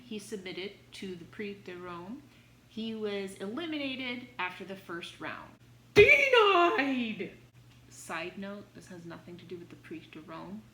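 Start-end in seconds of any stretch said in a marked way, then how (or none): 5.9-7.3 loud, high game-show "Denied!"; mock rejection shout. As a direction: bellow it like a buzzer verdict. Failed attempt, mock rejection.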